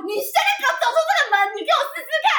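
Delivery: high, fast furious threat, not quite shouted; a shrill-pitched daring threat at speed; angry girlfriend. Retold or imitated. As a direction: dare him to walk out the door. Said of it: "后面女的就说".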